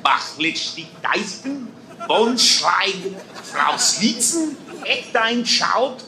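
hammed-up fake German accent. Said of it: "I'll speak to you now in fake German".

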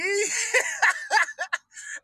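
laughter that cracks high, close to crying; high helpless laugh. Real, not acted. Mocking Trump's words, he breaks into high helpless laughter. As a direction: laugh until it squeaks.

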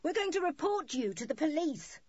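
high-pitched threat called out; angry call. A narrator's reading. Woman threatens children from the boat, calling angrily. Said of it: "called the woman".

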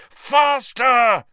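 high, loud shout at the broom; urgent shout. Acted. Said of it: "he growled at his nimbus".

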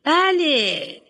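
lightly voiced headmistress, hesitant and prim; a hint of a prim school head choosing words; fussy headmistress. Headmistress praises Dolly.

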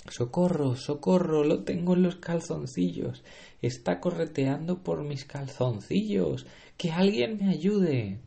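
high, frantic cries for help; screaming in fear. Acted. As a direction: shriek for help in panic. Chef panics, mouse in pants.

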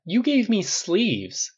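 high, slow whiny gripe; slow, high grumble to Tony about his sleeves; mock complaint. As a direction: grumble without real anger. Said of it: "Clint bitched without venom".

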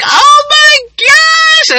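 very high, loud, drawn-out wail; hammed-up tearful shriek, stretched long; mock crying wail. Woman on the phone seeing first gray hair, mock wail. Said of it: "I'm sitting there crying".

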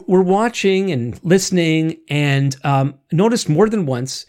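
ordinary talk, nothing marked

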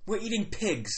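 high mock British voice; put-on British accent. Chief's son explaining the meal, put-on British accent. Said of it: "he is British".

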